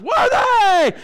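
high, drawn-out mock holler; imitated excited shouting. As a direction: holler it like an overexcited new convert. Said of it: "he couldn't stop shouting".

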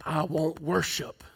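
slow, soft devilish demand; a hint of the devil craving worship; Satan's demand. Preacher quotes Satan's hunger for worship, slow soft devil. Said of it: "And he's saying".

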